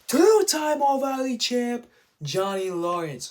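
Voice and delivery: loud, high, drawn-out arena introduction; referee announcer. Retold or imitated. Ref presenting Johnny Lawrence after correcting himself, slow loud announcer call. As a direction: stretch out the champion's title like an announcer. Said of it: "the ref comes out like".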